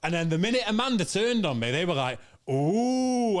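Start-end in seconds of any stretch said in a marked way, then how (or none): 2.5-3.4 long, high mock crowd "ooh"; raised, drawn-out taunting ooh; jeering crowd ooh. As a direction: imitate a studio audience turning on someone. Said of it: "they became like a Jerry Springer crowd".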